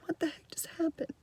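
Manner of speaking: hushed, whispered disbelief; baffled whisper. Retold or imitated. Teacher reacting to a child's sudden tears, whispered bafflement. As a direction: whisper it to yourself in bewilderment.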